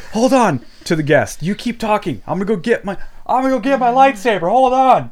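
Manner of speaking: eager hurried mock urgency; excited. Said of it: "it's like".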